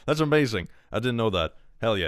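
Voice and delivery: ordinary talk, nothing marked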